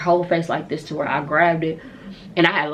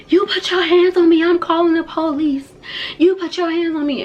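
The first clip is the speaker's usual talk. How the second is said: high, loud mock shriek; hammed-up, high-pitched yelling; hysterical threat; 13 semitones higher, 6 dB louder than usual. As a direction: imitate an outraged friend screeching threats. Friend threatens police, quarrel retold.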